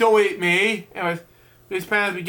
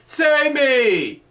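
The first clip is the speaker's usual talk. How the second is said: cry for help; 6 semitones higher than usual. Acted. Narrator cries for help in story.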